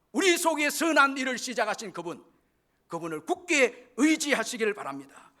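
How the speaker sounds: high, loud sermon exhortation; full-force preaching. Real, not acted. Preacher urging the congregation to believe without doubt, full-force preaching.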